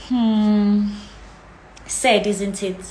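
ordinary delivery

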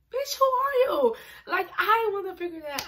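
high, loud demanding yell at herself; loud self-challenge. Real, not acted. Young mom yelling at herself: who are you. Shouting at herself about identity.

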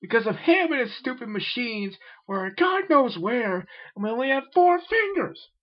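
high, loud ranting yell; a high furious rant; angry yell. A narrator's reading. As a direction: keep yelling in rage. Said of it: "yelled the W B champion".

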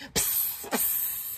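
unvoiced spitting hiss; spitting psst. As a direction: spit the sound out in disgust. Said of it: "he spat".

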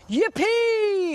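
high, loud yippee; excited cry. Acted. Giant cries out in joy at his birthday card. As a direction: burst out with joy. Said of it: "he cried".

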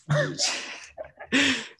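high burst of laughter. Real, not acted. Laughs at his Uber Eats job.

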